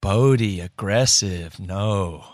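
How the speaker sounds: low, slow disapproving teacher voice; shaming teacher. Retold or imitated. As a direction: scold the boy with a frowny shaming tone. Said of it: "shame-based frowny face on her face and looks at him and goes".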